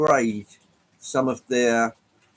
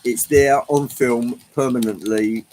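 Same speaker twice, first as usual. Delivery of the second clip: ordinary talk, nothing marked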